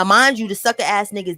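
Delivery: ordinary delivery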